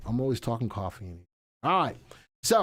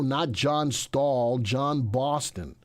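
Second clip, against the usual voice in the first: usual speaking voice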